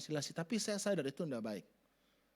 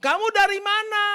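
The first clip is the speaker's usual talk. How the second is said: much higher and louder, accusing; accusing shout; 15 dB louder than usual. Shown as a demonstration. Preacher contrasting a harsh shouted question, high and loud. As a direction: yell it like a suspicious interrogation.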